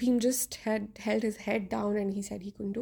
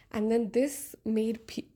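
ordinary talk

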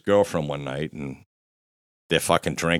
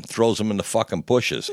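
ordinary talk, nothing marked

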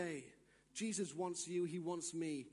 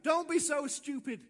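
raised, loud put-down; mock rebuke thrown out hard; sharp scolding; 6 semitones higher, 7 dB louder than usual. Lie scolds, then gentle answer.

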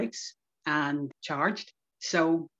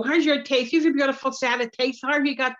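higher, louder teasing sing-song; mock teasing; 6 semitones higher, 4 dB louder than usual. Slagging the prisoner about his teeth in a higher, louder teasing voice. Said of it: "crucify him every time I see him".

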